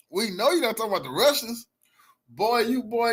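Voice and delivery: ordinary delivery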